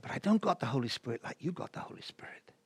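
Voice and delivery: very soft, half-whispered objection; hushed retold protest. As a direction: plead it under your breath. Frustrated seeker retelling his protest.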